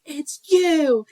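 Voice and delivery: high, drawn-out movie quote; dramatic high call, stretching YOU; Ron. Retold or imitated. Aunt quoting the movie while cleaning up, high dramatic cry. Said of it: "I was like".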